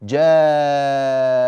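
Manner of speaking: "جاء" with the alif held very long and loud; drawn-out alif. Shown as a demonstration. Teacher showing students the long alif in "جاء". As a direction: hold the long "جاء" out slowly. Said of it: "مثل كلمة".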